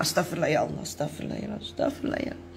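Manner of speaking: soft whisper of prayer, fading away; whispered istighfar. Shown as a demonstration. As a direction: whisper it to yourself to let the anger go. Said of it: "kita tapi segera istighfar".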